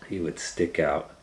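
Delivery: ordinary delivery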